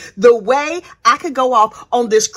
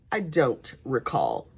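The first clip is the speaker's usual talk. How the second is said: low, slow, dismissive mumble; mock brother shrugging her off; deadpan denial; 12 semitones lower, 11 dB quieter, slower than usual. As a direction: drop it low and slow, a cold brush-off. Brother's flat denial voiced.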